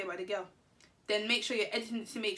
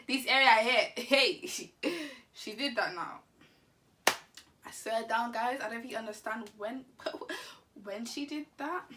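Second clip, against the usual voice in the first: ordinary talk, nothing marked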